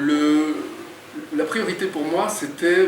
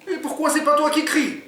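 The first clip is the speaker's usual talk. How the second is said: much higher, put-on voice for Oumar; puzzled sidekick; 4 dB louder than usual. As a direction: play the naive friend questioning. Oumar answers Jean.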